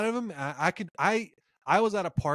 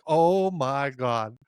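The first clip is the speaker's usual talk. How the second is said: a long drawn-out oh my God; slow awe; much slower than usual. Retold or imitated. A fan recalling watching Khabib, slowly and in awe. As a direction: let it out slowly in disbelief. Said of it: "I'm like".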